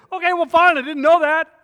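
high, loud and defensive; snapping back at brother's friends from a rock; defensive yell. Retold or imitated. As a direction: shout back at the mocking friends, embarrassed. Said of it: "I'm saying".

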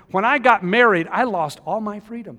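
loud pulpit preaching; full-force preaching. Acted. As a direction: preach it to the back pews.

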